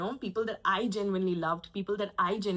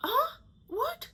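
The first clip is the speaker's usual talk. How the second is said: squeaky, much higher Huh? What?; playing dumb. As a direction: act clueless as the aunt. Aunt plays dumb again.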